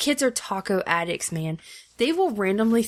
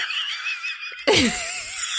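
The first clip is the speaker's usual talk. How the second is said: burst of laughter. Real, not acted. Laughing at own bad impression.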